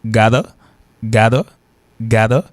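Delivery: gotta said as "GAH-duh", each one set apart; gotta, open first vowel, tapped middle; first pronunciation demo. Shown as a demonstration. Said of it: "il y a deux prononciations".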